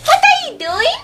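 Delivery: high, giddy excitement; excited squeal. Acted. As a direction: squeal with delight. Excited at entertainer.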